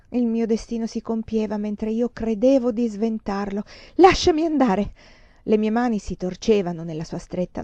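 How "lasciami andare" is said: higher, louder cry to be released; ordine agitato. A narrator's reading. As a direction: struggle free as you order it. Donna si divincola dalla stretta. (grido agitato per liberarsi)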